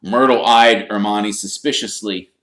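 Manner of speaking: ordinary delivery, nothing marked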